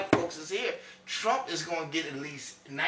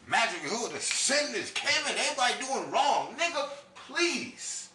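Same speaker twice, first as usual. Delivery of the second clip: higher, louder preaching rant; fired-up rant; 11 semitones higher, 3 dB louder than usual. Real, not acted. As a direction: build it up like a sermon.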